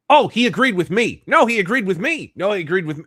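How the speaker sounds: high, loud mocking claims, easing on the last; hammed-up, high-pitched insistence; squabbling mimicry. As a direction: mimic rivals insisting over each other. Mimics people claiming his agreement.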